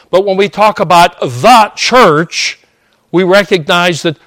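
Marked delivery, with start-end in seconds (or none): 1.2-2.6 "the" said as a loud, emphatic THEE before church; "the church" with heavy weight on THE; stress on THE. Real, not acted. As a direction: punch "the" high and loud, as in the one church. Contrasts universal church with local congregation.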